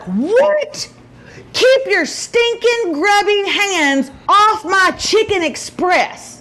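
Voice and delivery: put-on high, outraged teen squeal; mad daughter. Daughter snapping at mom over her chicken, mock outrage. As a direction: play the furious daughter guarding her leftovers. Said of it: "she was mad".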